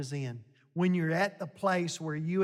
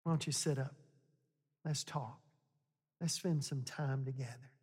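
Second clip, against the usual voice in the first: tender coaxing; 4 semitones lower, 7 dB quieter than usual. Acted. God voiced tenderly to Elijah.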